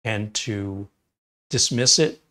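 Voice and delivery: ordinary talk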